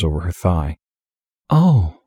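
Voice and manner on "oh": put-on high whiny girlish "Oh". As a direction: whine it out, high up. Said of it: "in her whiny little voice".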